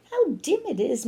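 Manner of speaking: ordinary delivery, nothing marked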